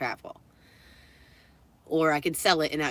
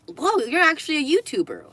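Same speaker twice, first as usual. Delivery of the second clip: high-pitched mock amazement; imitated wowed employee, voice jumping up; imitated impressed reaction; 9 semitones higher, 4 dB louder than usual. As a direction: gush like an awed shop assistant. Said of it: "she was just immediately imp-, impressed".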